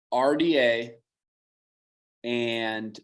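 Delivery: normal talking voice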